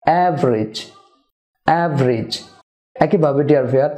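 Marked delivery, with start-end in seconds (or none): 0.0-2.3 "অ্যাভারেজ" as av-rij, each falling; two-syllable average. Shown as a demonstration. Teacher drills for viewers, "অ্যাভারেজ" as av-rij. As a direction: repeat "অ্যাভারেজ" as AV-rij with a pause between. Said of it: "না হবে অ্যাভারেজ".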